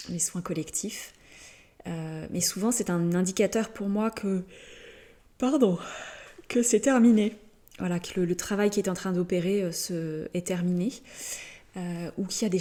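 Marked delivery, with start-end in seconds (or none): 5.4-7.4 higher, louder words pushed through a yawn. Real, not acted. Healer excusing themselves as the work ends, yawning. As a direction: finish the phrase mid-yawn. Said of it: "je bâille aussi beaucoup".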